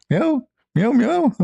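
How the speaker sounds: high squeaky cat meows; high mewing cat. Retold or imitated. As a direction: meow back like a sassy cat.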